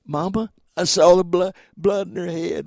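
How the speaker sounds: mild retold distress; upset boy. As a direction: plead with mama as a frightened boy. Upset boy insisting to mother.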